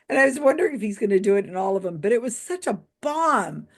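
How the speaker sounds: ordinary talk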